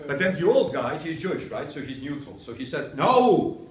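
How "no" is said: loud, raised mock objection; emphatic denial. Old guy corrects a student, emphatic denial. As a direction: cut in with a forceful, higher "no".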